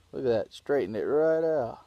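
talking around a cigar; cigar in mouth. Real, not acted. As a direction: lips clamped on cigar. Seen 0: Cigar held between lips throughout the labelled words.

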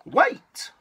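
high, loud call; little mouse crying out to stop; mouse calling wait. Acted. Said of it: "said the mouse".

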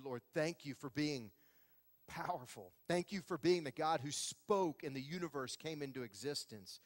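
soft, low prayer voice; a pastor prays softly for his congregation. Acted. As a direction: pray gently over the room.

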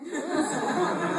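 loud high laugh breaking out; burst of laughter. Real, not acted. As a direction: laugh out loud before reading on. Reader laughing at text.

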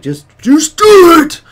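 very high, very loud drawn-out yell; motivational shout. Pretend. Streamer urging viewers to send artwork, hammed-up motivational shout. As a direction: bellow it slowly, hammed-up pep talk.

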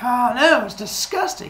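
high-pitched fake dismay; feigned disgust. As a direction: play badly acted revulsion.

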